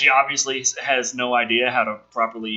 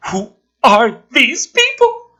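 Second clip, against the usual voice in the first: incredulous demand; 9 semitones higher, 7 dB louder, slower than usual. Acted. Wife confronting husband over photos.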